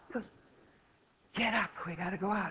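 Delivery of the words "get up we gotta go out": hushed urging. Retold or imitated. Boy to fallen dance partner on stage, hushed urging. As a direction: urge a partner on under your breath.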